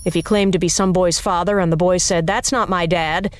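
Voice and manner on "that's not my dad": plain reading voice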